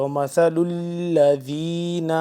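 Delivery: slow وَمَثَلُ الَّذِينَ with ذ soft, tongue between teeth; soft dhal. Shown as a demonstration. Teacher showing students the soft ذ, slow recitation. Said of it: "ذال یا زیر ... نرمی سے پڑھیں گے".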